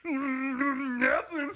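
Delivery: high comic hillbilly reply. Acted. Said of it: "be like to be a redneck homosexual".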